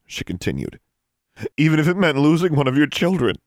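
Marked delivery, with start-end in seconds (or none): 1.6-3.3 high, loud, fast reproach; bitter accusation. Acted. Daughter reproaching her father for the loss, accusing. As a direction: hurl the charge at him.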